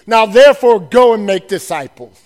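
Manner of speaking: commanding loud charge; loud proclamation. Acted. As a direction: boom out the commission. Commission proclaimed loudly.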